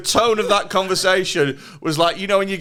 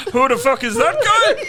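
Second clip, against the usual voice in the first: high, outraged mock Irish; Irish impression; 8 semitones higher, 4 dB louder than usual. Irish guru asking about the fighter, mock indignant Irish. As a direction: demand it in a hammed-up Irish voice. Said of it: "Conor McGregor Peterson".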